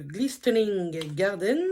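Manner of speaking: slow, careful attempt at English pronunciation; English accent. Real, not acted. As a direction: say the name slowly in an English accent. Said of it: "me féliciter également pour mon accent, euh, anglais".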